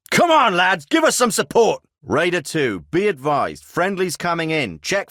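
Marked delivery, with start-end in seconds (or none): 0.1-1.8 high, strained yell; urgent call for backup. Acted. Soldier under attack calls lads for support, urgent high shout. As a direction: yell to your squad for cover. Pinned down, calling for help.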